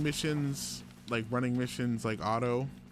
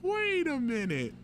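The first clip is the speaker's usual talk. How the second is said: surprised; 13 semitones higher than usual. Retold or imitated. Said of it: "I was like".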